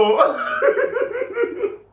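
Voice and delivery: high, loud burst of laughter; laughing. Real, not acted. Cracking up at own impression, real high laughter.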